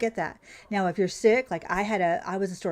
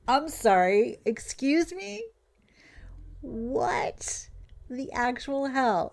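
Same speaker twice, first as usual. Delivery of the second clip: mock-polite disbelief, voice rising into the question; incredulous disbelief; 5 semitones higher, much slower than usual. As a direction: react in drawn-out, stunned disbelief. Acts out disbelief at stress cards.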